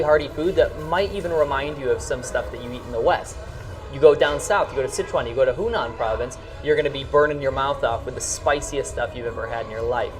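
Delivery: ordinary delivery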